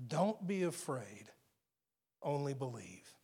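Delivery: hushed, comforting words to a frightened parent; tender reassurance. Acted. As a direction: gently reassure a grieving father. Jesus speaks to grieving father.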